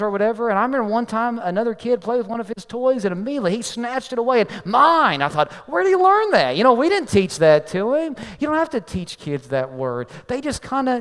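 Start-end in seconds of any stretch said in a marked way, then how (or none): 4.7-5.2 put-on loud, higher, possessive child's cry; toddler's grabby "Mine!". Kid snatches toy away. 5.7-6.5 much higher, louder astonished question; shocked disbelief. Retold or imitated. Father's shocked thought at son.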